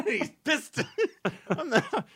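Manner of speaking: high, laughing echo; giggling as he repeats it. Real, not acted. Echoing friend's joke, laughing.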